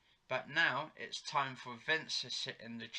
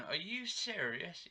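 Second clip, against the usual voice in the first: mock disbelief; 6 semitones higher than usual. Phil shocked, retold.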